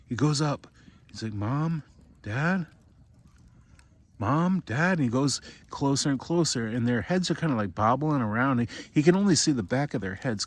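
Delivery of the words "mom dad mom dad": calling out. Retold or imitated. Boy calling for his parents.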